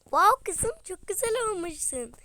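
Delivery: high, loud delighted wow; excited praise. Acted. Mother gushing over daughter's outfit, high and loud. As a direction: gush over her new look.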